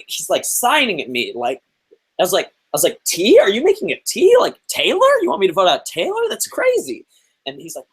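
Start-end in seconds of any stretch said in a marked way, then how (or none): 3.0-7.0 high, incredulous squeaky questions; mock-confused high-pitched guessing; confused disbelief. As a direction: act totally baffled by his gesture. I was so confused.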